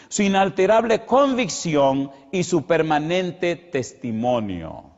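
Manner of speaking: loud, high, slowing preacher's proclamation; full-force preaching. Acted. Preacher to the congregation, loud and slow. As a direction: proclaim it from the pulpit, slowing on each phrase.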